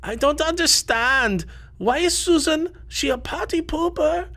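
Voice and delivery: very high, squeaky put-on voice; hammed-up foreign-accented falsetto; YouTuber impression. Mimicking a YouTuber for co-hosts, in a high accented voice. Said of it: "YouTuber that says".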